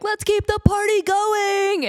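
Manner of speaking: high, slow, loud mock hype; hyped party call. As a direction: pump up the crowd in a high, drawn-out voice. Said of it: "the feeling of, like".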